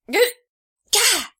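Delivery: a high, loud gah of surprise; the stranger jolts when hauled up; startled yelp. A narrator's reading. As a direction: yelp as you're grabbed.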